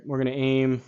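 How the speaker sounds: usual speaking voice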